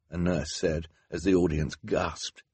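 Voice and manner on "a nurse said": ordinary delivery, nothing marked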